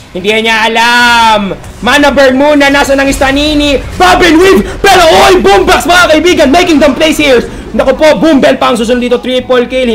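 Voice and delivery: very high, full-force shouted call; excited play-by-play. Real, not acted. Caster calling Boombox making plays, excited high shouting. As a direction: scream out the team fight as it happens. Shouts live game commentary.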